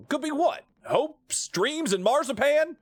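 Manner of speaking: high, slow teasing voice; mock a dreamer's hopes; a lightly voiced sarcastic crewmate; character voice. Voicing game character dialogue.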